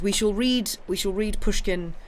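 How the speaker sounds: ordinary delivery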